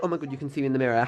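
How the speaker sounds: ordinary talk, nothing marked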